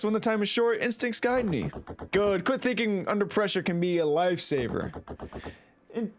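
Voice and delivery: normal talking voice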